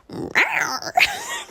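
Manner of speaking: very high playful growl; mock animal "rawr"; imitated growl. Retelling how he growled at her to be funny, playful growl. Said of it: "And he was like".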